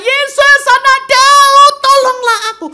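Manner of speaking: full-throated, very high, drawn-out holler. Acted. Blind beggar defying the crowd to reach Jesus, bellowing. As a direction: cry for help at the top of your voice. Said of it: "Tapi dia tambah kenceng".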